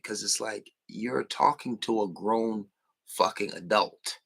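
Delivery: ordinary talk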